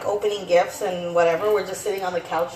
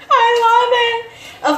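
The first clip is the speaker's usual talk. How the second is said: high, loud, drawn-out burst of love; excited gushing; 14 semitones higher, 6 dB louder, much slower than usual. Real, not acted. Vlogger gushing over the new Grinch, excited. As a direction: squeal it slowly with delight.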